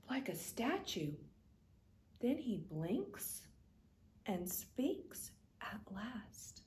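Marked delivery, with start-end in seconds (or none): 2.2-6.6 slow, whispered build-up; hushed narration. A narrator's reading. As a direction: hold them in suspense. Suspenseful narration before reveal.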